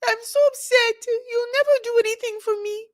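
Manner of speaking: mock the wife whining at her husband; shrill whiny wife. Mocking the wife yelling at her husband in a high whine. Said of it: "She's like".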